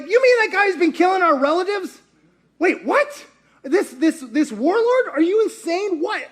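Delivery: high, disbelieving outburst; incredulous. Retold or imitated. Bystander stunned that the crowd wants the warlord, disbelieving. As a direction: react like it's insane.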